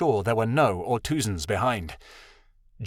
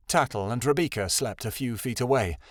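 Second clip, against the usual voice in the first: plain reading voice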